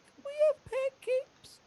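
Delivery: tiny, very high, slow plea; small child pleading hopefully; tearful little kid. Acted. Child asking mama for pancakes, tearful. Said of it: "He's got tears going down his face".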